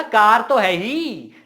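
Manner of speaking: loud, high, drawn-out insistence; cocky, slow and emphatic; swaggering boast. Acted. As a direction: brag it loudly, stretching every word. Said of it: "चौड़ में बोला".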